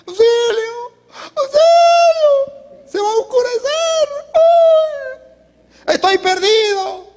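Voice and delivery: high-pitched, drawn-out yell; acted cry for help. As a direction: call out like someone lost on a mountain. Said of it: "y comenzó a gritar". (gritos agudos y alargados pidiendo auxilio)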